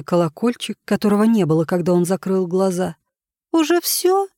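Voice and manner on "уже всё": put-on very high girlish voice, let down; a little girl let down it's over; disappointed girl. A narrator's reading. Giggly girl disappointed with Jenkins's game, high and deflated. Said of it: "Смешливая девочка была заметно разочарована".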